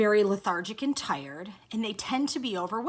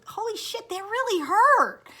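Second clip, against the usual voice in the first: high, pained exclamation; retold shock at sudden pain; 10 semitones higher than usual. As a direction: blurt it out as the pain hits. Retelling the painful knee poke.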